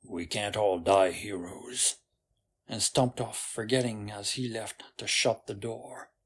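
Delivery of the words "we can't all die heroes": low-pitched grumbling mutter; low, grudging aside. A narrator's reading. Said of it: "he muttered".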